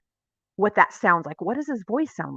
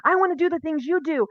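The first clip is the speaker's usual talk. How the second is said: eager follower; 7 semitones higher than usual. Retold or imitated. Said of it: "you're like".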